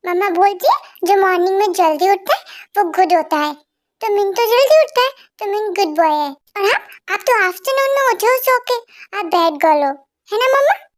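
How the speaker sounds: put-on high, chirpy little boy tattling on his aunt; small child voice. Little boy teasing his aunt in a childlike voice. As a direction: play a cheeky little kid showing off.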